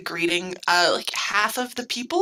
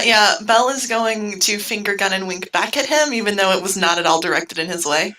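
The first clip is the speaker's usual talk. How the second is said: normal talking voice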